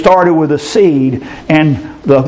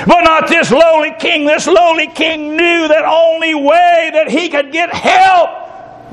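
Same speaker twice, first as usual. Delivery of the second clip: raised, fervent preacher's voice, slowing on key words; preaching at full force; 10 semitones higher than usual. Acted. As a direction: preach it out to the congregation. Preacher building fervour.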